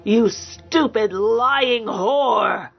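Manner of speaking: slow, drawn-out furious insult; mock scream. A narrator's reading. Raging man screaming at his lover, drawn-out fury. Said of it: "Sierran screamed at her".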